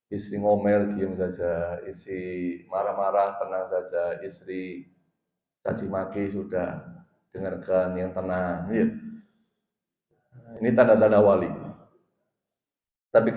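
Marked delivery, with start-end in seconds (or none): 0.1-8.7 low, hushed, even delivery; calm counsel. Shown as a demonstration. Preacher advising husbands to stay quiet when scolded, calm counsel. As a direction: lower and soften the voice to model patience.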